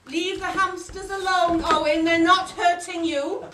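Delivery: loud firm telling-off; teacher loudly scolding a pupil. Real, not acted. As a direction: call him off the hamsters sharply. Teacher scolding pupil over hamsters.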